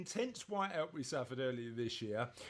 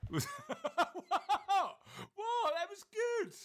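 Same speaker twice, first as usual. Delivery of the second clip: laughing; 4 dB louder, much slower than usual. Real, not acted. Laughing at co-host's jingle.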